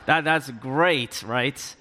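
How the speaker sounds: drawn-out, mock-pleased remark; sarcastic. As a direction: stretch it out with dry sarcasm. Sarcasm about being stuck at home.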